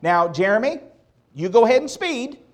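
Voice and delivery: a hint of a sweetly permissive mother; mock mom voice. Preacher voicing his own mom talking to teenage Jeremy, mock sweetness. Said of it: "my mom would never have said to me".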